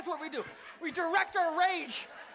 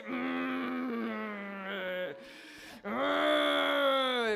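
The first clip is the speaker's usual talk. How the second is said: long, low drawn-out "mm"; rage growl; 5 semitones lower than usual. Shown as a demonstration. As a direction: demonstrate a slow rumbling growl for the crowd.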